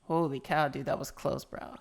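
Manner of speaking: normal talking voice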